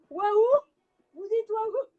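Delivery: loud delighted wow; excited. Real, not acted. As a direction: burst out in amazement. Coach exclaims, class echoes.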